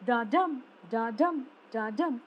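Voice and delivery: "da-dum" three times, weak DA, rising stressed DUM; heartbeat rhythm. Shown as a demonstration. Teacher imitating a heartbeat for rising meter, "da-dum" stressed on DUM. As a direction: beat out "da-dum" like a pulse, weight on each DUM. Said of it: "Like the pattern of the heartbeat".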